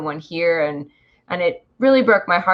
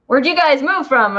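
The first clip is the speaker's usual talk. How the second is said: higher imitation of nosy askers; mock inquisitive question; curious people asking; 8 semitones higher, 4 dB louder than usual. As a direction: ask it as a puzzled local, pitched up. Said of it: "People are like".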